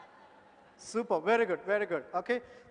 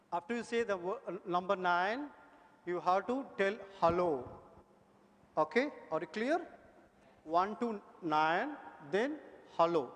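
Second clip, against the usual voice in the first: ordinary delivery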